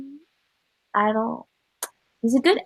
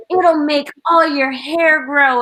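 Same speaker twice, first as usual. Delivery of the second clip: slow, higher hammed-up sales pitch; salesman voice; 5 semitones higher, 4 dB louder, slower than usual. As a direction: pitch it like a snake-oil seller. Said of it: "he's like".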